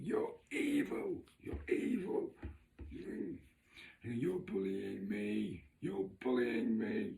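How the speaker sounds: high, slow, breathy screeching, "you're evil"; mock scream. Neighbour screaming accusations at the narrator, shrill mock. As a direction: squeal it like a hysterical neighbour.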